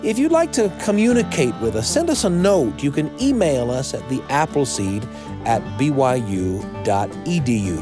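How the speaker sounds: usual speaking voice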